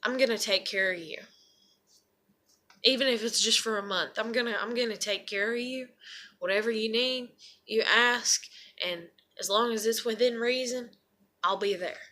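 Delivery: low, soft, reassuring offer of care; imagined caring friend. Pretend. Imagined friend at the door promising care, soft reassurance. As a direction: gently reassure as the friend who never came. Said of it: "nobody comes knocking on the goddamn door and is like".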